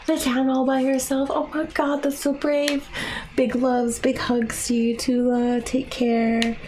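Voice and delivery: excited praise. Real, not acted. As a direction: beam with pride for her. Gushing to chatter about daughter.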